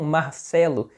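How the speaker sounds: "Marcelo" with an aspirated Bahian R; Bahian accent. Retold or imitated. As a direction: imitate Bahian friends saying "Marcelo", R softened to h. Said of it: "amigos meus de lá da Bahia falam".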